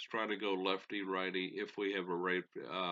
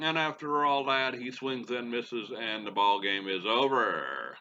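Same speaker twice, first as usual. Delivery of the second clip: louder, building TV play-by-play call; play-by-play announcer; 5 semitones higher, 14 dB louder than usual. Acted. Gamer calling the final at-bat, broadcaster play-by-play voice. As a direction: sell the last at-bat like a broadcaster.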